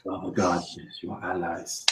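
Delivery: very soft, low, breathy realization; hushed relief. Acted. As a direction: exhale it as quiet, overwhelmed relief. Vizier realizes they are allies.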